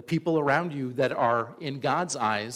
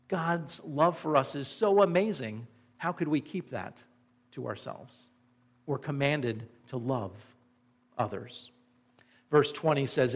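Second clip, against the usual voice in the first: normal talking voice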